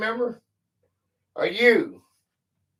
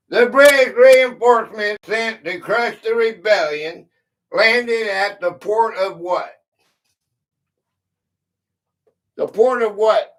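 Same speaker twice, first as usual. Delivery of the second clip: clue read out louder, pressing viewers for the answer; 2 semitones higher, 7 dB louder than usual. Real, not acted. Host calling out the clue to the chat, louder and insistent. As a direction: push the question at the chat.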